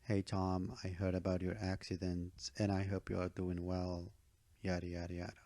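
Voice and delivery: flat, uncaring monotone demo; robotic recital, no concern in it; emotionless. Shown as a demonstration. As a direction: say it with no feeling, just reeling it off. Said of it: "That's not a, an emotional being talking here".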